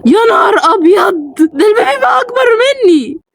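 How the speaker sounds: high, near-crying panic; terrified shock. Real, not acted. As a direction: wail in fright at what just appeared. Shock at the giant baby.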